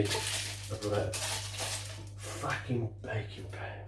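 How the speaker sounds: whispered swear under the breath; a whispered curse in annoyance; a whispered aside while baking. Real, not acted.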